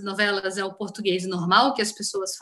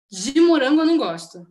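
"morango" stressed, louder; contrastive stress; 3 semitones higher, 5 dB louder than usual. Shown as a demonstration. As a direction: lean on "morango" to imply other flavors are fine. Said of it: "Isso é um tom de voz".

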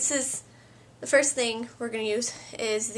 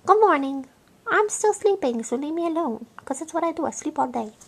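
voicing the dog; 3 semitones higher, 4 dB louder than usual.

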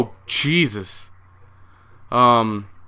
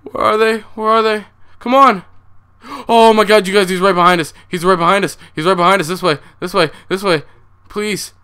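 higher, louder urgent calls; frantic shouting; 8 semitones higher, 6 dB louder than usual. Real, not acted. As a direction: yell directions while fleeing in fear. Fleeing monster with friends.

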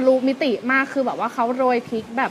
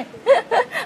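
laugh; 11 semitones higher than usual. Real, not acted. Laughing at hidden green chili.

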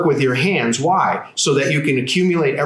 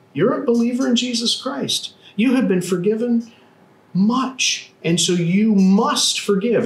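emphatic preaching; 9 semitones higher than usual. Real, not acted. Pastor's emphatic preaching point.

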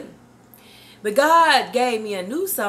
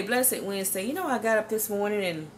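ordinary talk

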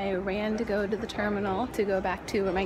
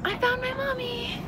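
put-on little kid voice; 14 semitones higher than usual. Vlogger looking for her mom, childish high voice. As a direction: sound like a lost little kid.

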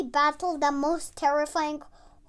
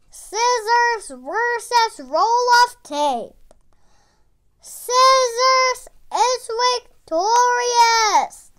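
slow, loud, higher ring announcer call; fight announcer; 6 semitones higher, 9 dB louder, slower than usual. Acted. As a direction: draw out the victory call. Announcer declares the winner.

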